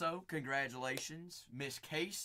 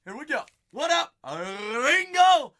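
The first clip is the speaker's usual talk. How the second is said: much higher and louder, building to a yelled Ringo; hyped shout; 10 semitones higher, 15 dB louder than usual. Real, not acted. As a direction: hype up the chat like a game show host. Hype greeting to stream viewer.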